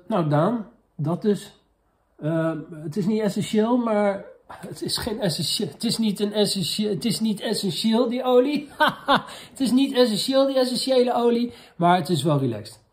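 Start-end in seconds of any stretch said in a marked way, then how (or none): 2.2-9.5 giggling through the tongue-twister; laughing. Real, not acted. Vlogger cracking up over essential oils, laughing. 9.5-11.5 laughing. Real, not acted. Vlogger cracking up at his own pun, laughing.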